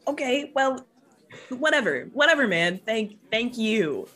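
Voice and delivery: high-pitched, exasperated giving in; flustered brush-off. Acted. Acted flustered brush-off after teasing.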